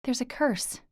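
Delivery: high, tearful confession; on the edge of crying; holding back tears. A narrator's reading. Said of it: "Before the tears bubbled out".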